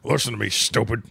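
lightly voiced Redd Foxx, raspy scolding; a hint of Redd Foxx calling someone stupid; Red Foxx impression. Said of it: "you're doing Red Fox".

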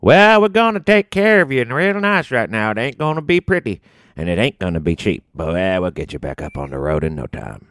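put-on high-pitched old country codger; old Southern small-town mechanic reassuring a customer; Old Man Gaffney. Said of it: "Old Man Gaffney. That guy, that character".

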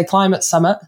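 Climate Summit in a northern English accent. Shown as a demonstration. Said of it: "I think it's my northern accent".

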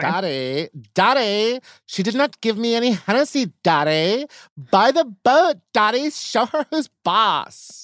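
high-pitched whiny begging; hammed-up bratty child voice; spoiled kid. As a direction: plead up high to daddy like a brat. Parody of spoiled kid begging dad.

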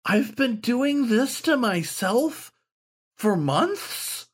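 high, slow, whimpering; puppyish whimper. A narrator's reading. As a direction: let the dismay whimper out. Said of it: "a puppyish whimper out of his voice".

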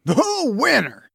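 high exclamation in a character voice; shouting. A narrator's reading. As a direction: call it out to the whole bar. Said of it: "shouted Horace".

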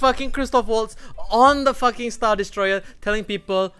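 usual speaking voice

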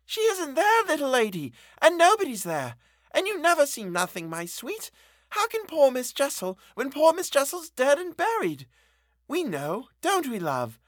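high, soothing motherly reassurance; breathless reassurance. A narrator's reading. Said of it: "breathless reassurance".

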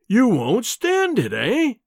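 slow, high mocking sneer. A narrator's reading. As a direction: taunt him with a sneer.

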